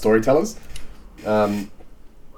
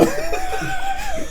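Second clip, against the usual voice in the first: high giggle. Real, not acted.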